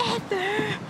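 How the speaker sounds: high, ringing exclamation; high, excited cry; emphatic. Real, not acted. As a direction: shout the last word for emphasis. Emphatic repeat of best day ever.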